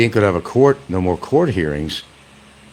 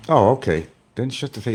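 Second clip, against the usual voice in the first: ordinary talk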